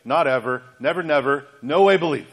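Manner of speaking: emphatic refusal. Retold or imitated. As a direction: refuse flat out, every no hammered. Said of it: "It's like saying".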